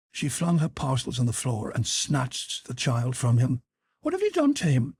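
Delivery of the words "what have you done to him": high-pitched accusing mother; high, frantic demand; accusing cry. A narrator's reading. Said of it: "she cried, glaring into his face".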